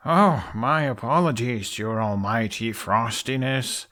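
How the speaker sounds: slow, mocking apology; sarcastic, slow mock bow in the voice; dripping sarcasm. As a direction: drag out the fake respect. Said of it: "sarcasm dripping from his words".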